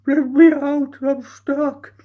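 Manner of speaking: high, loud whiny mock sob; mock crying. Peter stuck under rubble, mock crying for help. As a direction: whimper it like a trapped kid, hammed up. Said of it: "And he's, like, crying".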